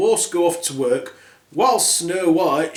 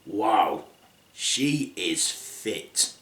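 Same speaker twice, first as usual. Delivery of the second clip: lecherous dwarf; 7 semitones lower, 6 dB quieter, much slower than usual. Acted.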